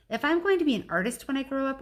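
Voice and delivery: high, quick whiny complaint; Julia complaining.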